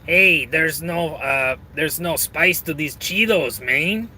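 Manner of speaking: put-on slow, drawn-out Mexican accent; casual story character with a Mexican accent. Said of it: "best Mexican accent I could do".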